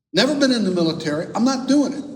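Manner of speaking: exasperated refusal. Retold or imitated. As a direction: act out being fed up and refusing. Final exasperated refusal to friend, acted.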